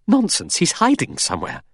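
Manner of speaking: sharp impatient nonsense, brushing them off. A narrator's reading. Vera to the men, impatiently dismissing the news. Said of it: "Vera said impatiently".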